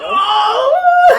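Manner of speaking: high, loud shocked cry; shocked shout. Real, not acted. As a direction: yelp in disbelief. Host learns guest loves Domino's.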